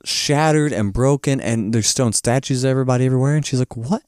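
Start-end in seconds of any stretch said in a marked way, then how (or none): 3.8-4.0 mock hushed shock. Acts Dorothy seeing ruined city.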